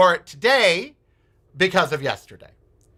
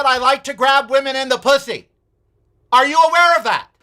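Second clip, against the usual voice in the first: heated retort; 8 semitones higher than usual. Retold or imitated. Telling off the MAGA neighbour in the park, indignant and climbing. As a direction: confront her with mounting outrage.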